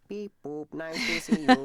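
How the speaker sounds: robot voice. Pretend. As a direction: beep out a mock robot greeting. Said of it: "Are we all robots now?".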